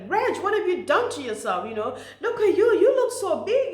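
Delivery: high, loud mocking shriek of shocked acquaintances; imitating people yelling in disbelief; retold scream. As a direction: shout it out like an old friend who is appalled. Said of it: "they would scream at me like".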